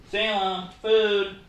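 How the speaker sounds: called out high and loud; calling the cat. Real, not acted. Calling Salem the cat over for food, high and loud. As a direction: sing out to the cat for dinner.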